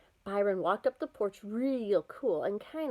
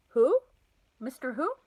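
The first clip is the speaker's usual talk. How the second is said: surprised; 5 semitones higher, 5 dB louder than usual. A narrator's reading. Mother surprised at the news.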